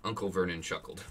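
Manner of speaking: ordinary delivery, nothing marked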